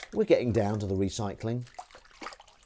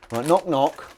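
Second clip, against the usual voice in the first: louder knock knock; 4 semitones higher, 6 dB louder, slower than usual. Shown as a demonstration. Repeats the joke opening louder.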